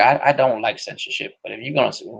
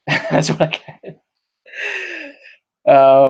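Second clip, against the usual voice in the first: laughing; 5 semitones higher, 5 dB louder, much slower than usual. Real, not acted. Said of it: "laughs through it".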